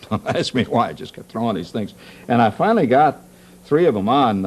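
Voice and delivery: ordinary talk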